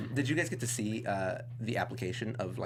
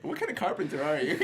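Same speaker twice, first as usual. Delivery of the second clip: laughing disbelief; 6 semitones higher, 4 dB louder than usual. Retold or imitated. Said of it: "I was like".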